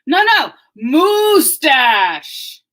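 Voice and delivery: very slow, loud, stern shout; glaring moose. Acted. Moose corrects the other animals, slow angry shout. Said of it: "with a glaring look in his eye".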